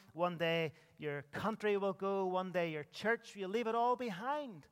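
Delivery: ordinary talk, nothing marked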